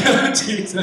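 high, loud, hammed-up weeping; mock crying. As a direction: wail it tearfully for laughs. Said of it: "I'm like, crying".

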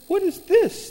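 high, astonished question; stunned at the pile of cash; surprised. Retold or imitated. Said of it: "she goes".

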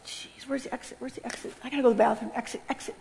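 hushed, near-whispered panicky muttering; furtive whisper. Retold or imitated. Would-be escape artist in church, furtive whisper. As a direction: mutter it under your breath, hunting a way out. Plotting escape under her breath.

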